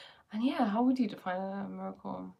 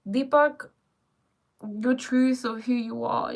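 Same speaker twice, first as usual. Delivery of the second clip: usual speaking voice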